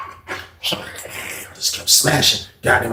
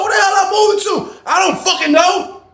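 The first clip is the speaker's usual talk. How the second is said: high heated shout. Retold or imitated. Asking Joe, heated.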